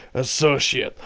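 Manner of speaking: ordinary talk, nothing marked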